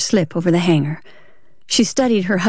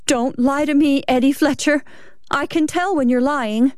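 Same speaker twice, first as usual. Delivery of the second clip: higher-pitched, upset accusation; accusing wife; 6 semitones higher than usual. Acted. Wife calling out her husband's lie, accusing and high. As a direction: accuse him, pitch raised.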